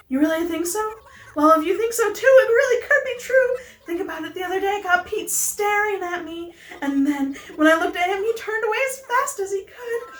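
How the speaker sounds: high, excited lovestruck voice; Pelly. Acted. Pelly tells friend about Pete staring, high and hopeful. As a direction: build up eagerly in a high character voice.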